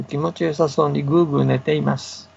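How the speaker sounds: plain reading voice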